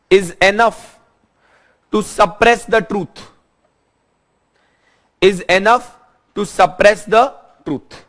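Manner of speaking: slow, measured note-dictation delivery. Real, not acted. Teacher giving students notes to write down, slow dictation. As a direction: dictate in short phrases with long pauses.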